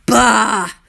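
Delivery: put-on loud, slow, complaining old landlord; grumpy old man. The mean landlord grumbling at noisy birds, loud and cranky. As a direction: grumble it like a crotchety miser. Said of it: "complained the old man".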